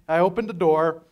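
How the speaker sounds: ordinary talk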